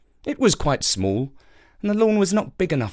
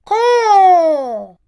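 high loud caw; crow caw; 9 dB louder than usual. Acted. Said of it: "all the crow said was".

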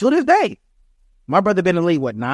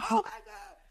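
awed fans; 13 dB quieter than usual. Retold or imitated. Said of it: "the fans like".

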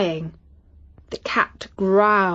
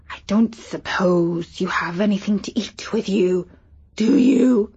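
lightly voiced talking cat, hungry; a sly hungry cat asks politely; a mild cat character voice; big cat voice. Cat voice held across lines.

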